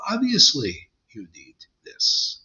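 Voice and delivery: hushed, mocking whisper on need this. Guru mocking a devotee who trusts only books, in a whisper. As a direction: whisper it like a smug know-it-all.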